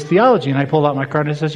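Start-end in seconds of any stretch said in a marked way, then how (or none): 0.0-0.5 higher, louder disbelieving repeat; shocked echo of his answer; incredulous echo. Retold or imitated. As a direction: repeat the word as if it can't be real. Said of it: "And he goes".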